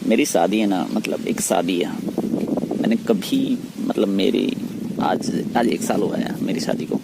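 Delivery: ordinary talk, nothing marked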